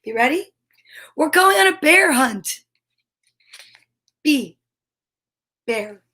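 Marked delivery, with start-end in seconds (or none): none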